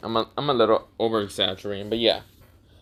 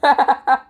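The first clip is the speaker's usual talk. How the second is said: high, loud acted giggle; snickering giggle; 7 dB louder than usual. Naruto giggling at Mizuki's fear, high snicker. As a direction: burst into a put-on high snicker. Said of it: "He kinda snickers and giggles a little bit".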